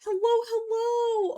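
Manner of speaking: high, bright, drawn-out hellos; excited greeting. Real, not acted. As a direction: greet the chat with big excitement. Streamer greets chat going live.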